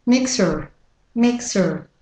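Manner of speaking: American "mixer" with a sounded R; American-accent word demo. Shown as a demonstration. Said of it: "And with the American accent".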